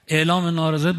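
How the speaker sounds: usual speaking voice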